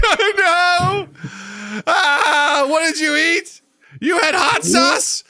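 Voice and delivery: high, loud shrieking complaint; horrified toilet. Pretend. Toilet screaming in horror at the user's hot sauce, shrieking. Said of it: "It's like".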